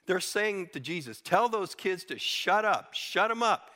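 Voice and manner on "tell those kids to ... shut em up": mock furious priests. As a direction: voice the priests' indignant order, somewhat hammed-up. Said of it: "they were furious".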